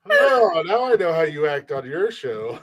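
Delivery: ordinary talk, nothing marked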